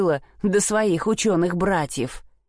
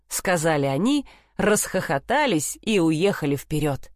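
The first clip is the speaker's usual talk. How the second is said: plain reading voice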